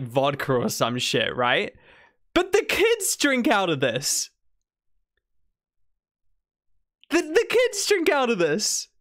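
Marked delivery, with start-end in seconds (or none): 2.3-8.9 high-pitched, incredulous protest; appalled disbelief. Real, not acted. Streamer aghast that children drink the spiked punch, appalled. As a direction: exclaim it in higher-pitched dismay.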